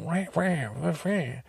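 high-pitched imitated cat wail; imitation meowing complaint; cat yowl. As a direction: mimic a yowling cat in repeated wahs. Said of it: "And the cat's like".

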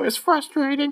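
very high mimic falsetto; parroting Popo voice. Quoting Popo while poking a girlfriend, high falsetto. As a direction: squeak the catchphrase in falsetto. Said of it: "just go".

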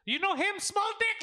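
high-pitched mock heckle; imitated yelling. Friend imitates mom heckling her son before women, yelling high. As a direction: holler it high, like a taunting mom. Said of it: "her mom will yell crazy shit".

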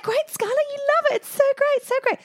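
high, loud, over-bright praise; gushing mum. Retold or imitated. Mum gushing to Skylar about jazz ballet, high and eager. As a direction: gush at the child to sell it.

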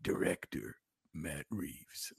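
low-key, slow and soft Batman delivery. Shown as a demonstration. Demonstrating reading the stats for the bet, Bat voice. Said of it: "the whole thing in Bat voice".

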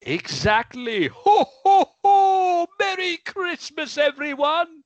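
high, loud Santa laugh and greeting; hearty Santa wishing everyone Merry Christmas; jolly Santa. Acted. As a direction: boom the farewell as Father Christmas. Santa signs off.

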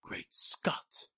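breathless whispered realization; whispered exclamation. Acted. Suspect grasps a clue, whispering in shock. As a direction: whisper it to yourself as it dawns.

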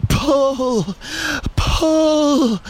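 high, stretched heave-ho cries; straining team shout. A narrator's reading. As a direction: cry it out long with effort. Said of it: "everyone shouted".

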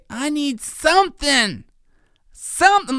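put-on high, slow little-kid whine of "I need something"; small child's pleading voice; toddler voice. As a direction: play the toddler stalling at bedtime. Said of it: "And he'll say".

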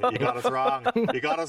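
high, squeaky outraged correction; hammed-up high-pitched huff; mock indignant protest. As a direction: set him straight in a shrill mock-offended voice. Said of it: "and I just said".

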